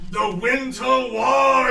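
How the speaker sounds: slow, loud dramatic naming; old Frug naming the monster; ominous naming. Acted. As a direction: drag out the monster's name. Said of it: "he goes".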